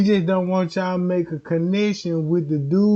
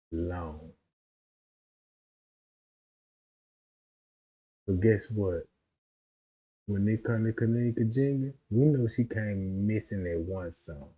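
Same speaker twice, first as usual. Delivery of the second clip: much softer and lower; hushed; 8 semitones lower, 8 dB quieter than usual. Real, not acted. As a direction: lean in and lower your voice. Drops to low quiet storytelling.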